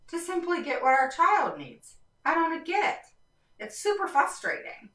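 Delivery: high-pitched, exasperated plea; frustrated. Real, not acted. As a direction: let real frustration push your voice way up. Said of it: "My voice will go up and down the more frustrated I get".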